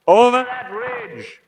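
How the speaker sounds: a gentleman shouting directions down to the police. Acted. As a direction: yell the directions so they carry. Repeats louder to police.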